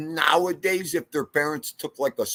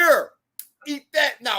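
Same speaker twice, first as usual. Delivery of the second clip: mock yelling parent; 10 semitones higher than usual. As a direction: bark it like an angry mom. Parent voice retold.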